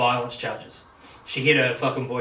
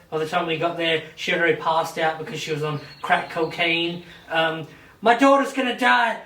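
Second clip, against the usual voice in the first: pleading father; 4 semitones higher than usual. Retold or imitated. Father begging the judge for custody, higher urgent mock plea. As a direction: pile it on like a frantic dad.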